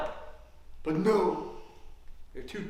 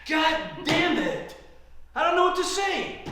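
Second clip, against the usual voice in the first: exasperated outburst; 8 semitones higher than usual. Acted. Card player curses opponent's gin, exasperated. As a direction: curse the lost hand in rising frustration.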